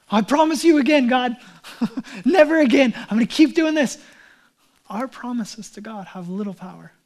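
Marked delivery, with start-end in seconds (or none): 0.1-4.0 higher, louder hammed-up vow; mock exasperated vow. Believer promises God again, hammed-up and loud. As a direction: ham up a sinner's broken promise. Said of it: "what happens".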